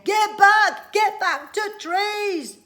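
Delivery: high, loud desperate scream; screaming warning. Acted. Father screaming at the children to flee. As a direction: scream it at them to run. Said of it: "screaming blue figure".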